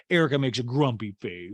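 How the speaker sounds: ordinary talk, nothing marked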